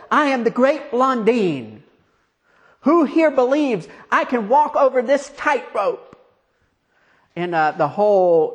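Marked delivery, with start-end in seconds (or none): 0.1-6.2 raised, ringing showman's boast; showman whipping up crowd. Acted. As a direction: work the crowd up, pitched high. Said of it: "he was whipping up the crowd into a frenzy".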